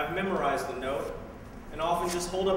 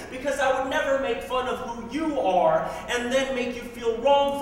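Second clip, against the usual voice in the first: impassioned, pointed open-letter recital; emphatic poem, slowing to a close; spoken word poem; 6 semitones higher, 3 dB louder than usual. Acted. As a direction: drive the argument home to the one addressed.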